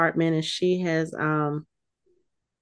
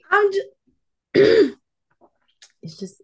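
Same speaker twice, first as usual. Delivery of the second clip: ordinary talk, nothing marked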